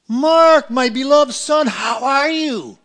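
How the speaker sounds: a loud, tender God calling his son. Retold or imitated. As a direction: call out as a loving, booming God. God's voice in the retold scene.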